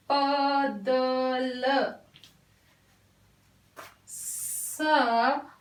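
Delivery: drawn-out word reading; very slow dictation. Shown as a demonstration. Teacher slowly dictating words to children. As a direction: stretch each word very slowly. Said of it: "देखते हैं".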